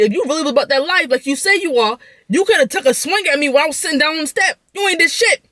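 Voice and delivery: pitched high, scolding and defiant; heated telling-off. Retold or imitated. Daring the auntie who slammed the door, a heated high scold. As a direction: take the auntie down a peg.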